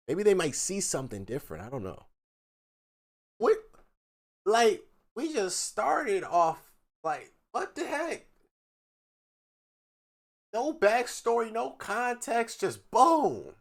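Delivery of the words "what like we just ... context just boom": high, exasperated disbelief; indignant, pitched-up what the heck; incredulous outrage. Real, not acted. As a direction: sound baffled at how abrupt it is. Reacting to an abrupt text.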